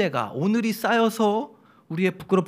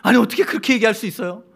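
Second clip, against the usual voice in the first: louder, fast, offended whine; mock pouting complaint, raised and quick; sulky protest; 5 semitones higher, 6 dB louder, faster than usual. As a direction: play the sulking wife taking offence. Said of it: "에스더가 삐지는 거예요".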